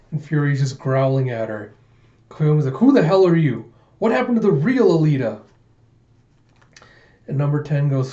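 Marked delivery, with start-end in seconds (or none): 2.7-5.4 high, loud questioning; acted heated challenge; angry demand. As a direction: confront the impostor head-on. Koyomi confronts the impostor.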